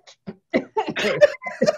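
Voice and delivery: high giggling laugh; high giggle. Real, not acted. Host remembering eggs with ketchup, high giggle. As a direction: giggle at the memory.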